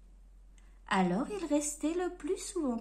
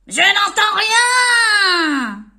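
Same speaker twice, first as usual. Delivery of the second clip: very slow, very loud yell; drawn-out furious shout; bellowing; 6 semitones higher, 18 dB louder, much slower than usual. Acted. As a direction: roar it over the market noise. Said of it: "vociféra le marchand de légumes".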